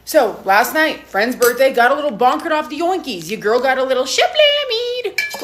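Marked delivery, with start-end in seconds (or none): 3.3-5.4 high, slow, silly put-on voice; playful high voice. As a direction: ham up the drunk slang. Playful slang self-description.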